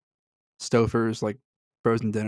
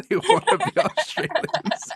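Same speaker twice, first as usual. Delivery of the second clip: laughing, much higher mock Australian accent; 3 dB louder than usual. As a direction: tease the Brits in a put-on Australian accent, pitched way up. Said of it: "Maybe your Australian accent".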